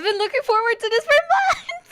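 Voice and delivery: bubbling over with joy; giddy excitement. Real, not acted. Streamer gushing to chat, giddy and high. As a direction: pitch jumps up, laughing through it.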